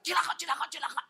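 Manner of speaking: high, loud repeated yelp; mock panicked cry. Shown as a demonstration. Preacher showing what not to yell in danger, mock panic. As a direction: imitate someone crying out in alarm. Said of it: "jangan teriak".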